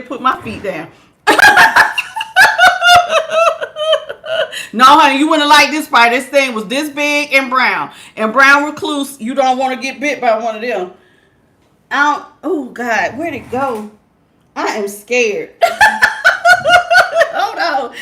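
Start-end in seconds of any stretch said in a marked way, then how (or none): 1.2-4.7 high, nervous shriek of laughter. Real, not acted. Spooked by a spider, she shrieks with laughter. 15.6-17.3 high, nervous shriek of laughter. Real, not acted. Spooked by a spider, she shrieks with laughter.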